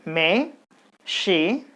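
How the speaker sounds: "machinery" stretched slowly, beats apart; slow syllable count. Shown as a demonstration. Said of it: "there are four syllables".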